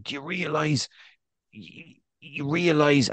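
a hint of a pint-heavy superfan pressing the point; drunk fan. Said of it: "he's had four or five pints".

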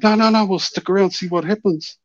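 high, insistent no; pleading. Retold or imitated. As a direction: beg her to stay. Urging her again.

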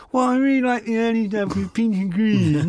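high, babbling mock-youth voice; squeaky young fan. Twenty-year-old fan answering rock star, squeaky babble. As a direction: gabble in a tiny high voice.

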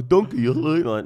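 loud, goofy donkey voice; silly donkey voice. Shown as a demonstration. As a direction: play a daft cartoon donkey. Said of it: "making up all these stupid voices".

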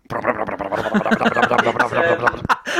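lip-flapping helicopter rotor sound; helicopter noise. Retold or imitated. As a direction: blow a wobbly chopper noise through the lips. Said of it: "Dan's impression of a helicopter".

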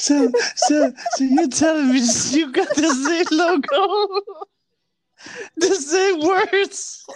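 high, laughter-choked questioning; laughing disbelief. Real, not acted. Co-host questioning the copied logo, cracking up. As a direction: draw out the last words through laughter.

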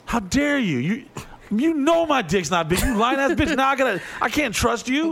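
high, hammed-up indignant rant; mock outrage. A comic acting out his fury at a lying girlfriend. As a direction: rail at her, comically betrayed.

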